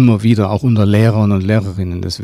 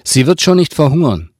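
louder, higher dismissive retort; brusque dismissal with raised pitch and volume; impatient interruption; 6 semitones higher, 4 dB louder than usual. A narrator's reading. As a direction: cut him off impatiently. Woman cuts the driver off.

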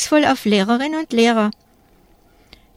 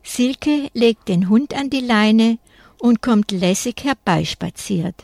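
ordinary delivery, nothing marked

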